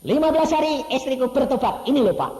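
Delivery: loud, high happy announcement; proud excited report. Retold or imitated. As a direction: boast with joy. (laporan bangga, keras dan tinggi)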